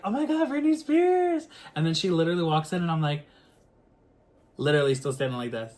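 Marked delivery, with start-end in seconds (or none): 0.0-1.5 much higher, louder gasping fan voice; starstruck gasp. Retold or imitated. Cousin spotting [name] at the door, a much higher starstruck gasp. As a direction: gasp her name like you can't believe she's there. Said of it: "and then my cousin's like".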